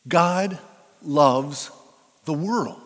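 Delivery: each word set apart with a pause; God, loves, the world, with pauses between; spaced emphasis. Shown as a demonstration.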